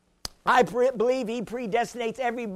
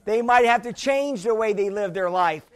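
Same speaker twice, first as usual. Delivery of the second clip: ordinary talk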